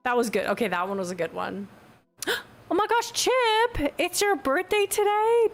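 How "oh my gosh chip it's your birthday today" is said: gasp, then high-pitched delight; excited surprise. Real, not acted. Streamer learning it is a viewer's birthday, excited surprise. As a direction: burst out high in happy surprise.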